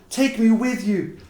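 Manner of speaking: put-on high, slow little girl's voice, pleading; child's plea. A narrator's reading. Little match girl begging her grandmother, high, slow child voice.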